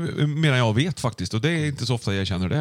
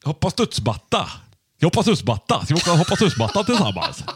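higher-pitched Västergötland dialect; put-on Skövde dialect; Västergötland accent; 4 semitones higher than usual. Shown as a demonstration. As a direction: have a go at playful Västgötska. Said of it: "Västgötska är ju nära oss".

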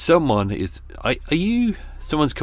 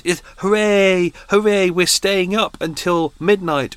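sarcastic cheer, higher, louder and slow; mock party cheer; 9 semitones higher, 5 dB louder, slower than usual. Mocking people who stay up till midnight, sarcastic high cheer. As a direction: ham up the fake excitement.

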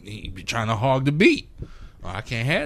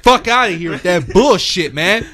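higher, louder mock insult with a laugh; hammed-up cussing son; 8 semitones higher, 7 dB louder, slower than usual. Son roasting dad's beat, loud hammed-up kid. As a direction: play the kid trashing the beat loudly.

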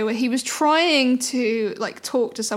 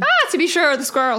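playful put-on Irish lilt; Irish accent; 3 semitones higher than usual. Joking that it sounded Irish, mock Irish accent. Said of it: "sounded a little bit Irish".

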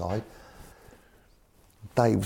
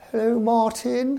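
put-on posh accent, high drawn-out greeting; Eddie Rogers; 4 dB louder, slower than usual. Chairman rings his manager, mock posh high-pitched voice. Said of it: "'cause very posh, Eddie".

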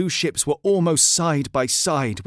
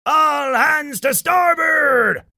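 long, high, loud pirate shout; captain's cry; 13 semitones higher, 6 dB louder, much slower than usual. A narrator's reading. Old Jim orders all hands to starboard, crying out. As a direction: call out the order slowly across the ship. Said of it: "cried Old Jim".

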